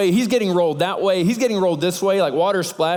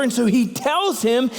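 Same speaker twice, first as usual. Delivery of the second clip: normal talking voice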